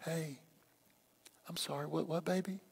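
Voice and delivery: barely audible, tender apology; very hushed, soothing murmur to a partner. Shown as a demonstration. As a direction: say it extremely softly, almost under the breath. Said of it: "say it softer than you would".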